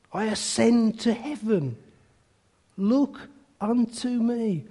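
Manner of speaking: soft, drawn-back preaching voice; hushed plea. Acted. Cobbler speaks as Christ dead and risen, hushed plea. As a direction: let the plea fall to a reverent hush.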